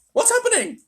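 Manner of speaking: very high, startled question; alarmed cry. A narrator's reading. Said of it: "cried James".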